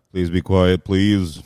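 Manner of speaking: hushed announcer in English. Retold or imitated. Imitating tennis court announcer. (imitando al anunciador de tenis pidiendo silencio)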